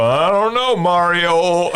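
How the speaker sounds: high, loud cartoon brother voice; Luigi. Luigi answering Mario about his rear end, high mock cartoon voice. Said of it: "And Luigi's gonna go".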